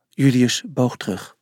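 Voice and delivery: ordinary delivery, nothing marked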